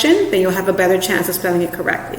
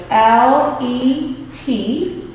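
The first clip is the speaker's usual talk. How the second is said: very slow letters L E T; teacher spelling letter; spelling out slowly; 3 semitones higher, much slower than usual. Shown as a demonstration. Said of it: "this one has a double letter".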